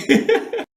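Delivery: high giggling burst; short laugh. Real, not acted. Restarts the topic then laughs again.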